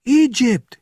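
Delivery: very high, loud scream. A narrator's reading. Officer on the phone screaming the news, very high and loud. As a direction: scream the name in panic. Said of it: "he screamed".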